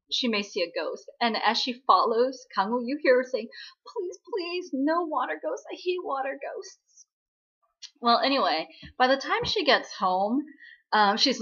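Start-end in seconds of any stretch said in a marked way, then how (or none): 3.9-7.1 high, softened mock pleading; fearful pleading. Following her date near the river, dreading ghosts, fearful pleading. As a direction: plead anxiously under your breath, pitched high. Acts out frightened muttering while following.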